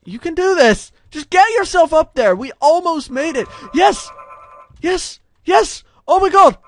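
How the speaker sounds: high, loud, urgent shouts; excited cheering. Real, not acted. Gamer nearly finishing the level, excited cheering. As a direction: cheer the character on at full voice. Cheers avatar near the goal.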